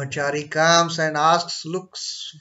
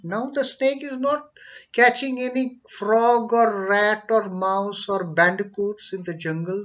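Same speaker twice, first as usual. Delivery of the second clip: normal talking voice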